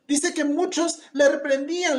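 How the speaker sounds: ordinary talk, nothing marked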